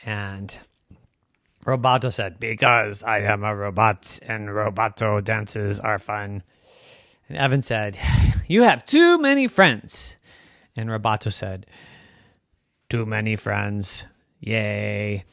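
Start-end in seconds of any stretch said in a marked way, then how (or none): 2.4-6.4 low monotone robot answer; reply in an even robotic drone. Acted. Robot defending its dances to Evan, monotone robot voice. 8.5-10.2 high, loud exasperation on TOO; complain, hitting "too" high; exasperated complaint. Acted. Evan fed up with robot's guests, exasperated. 12.9-15.3 low, deadpan robot cheer. Acted. Robot cheering its many friends, monotone robot voice.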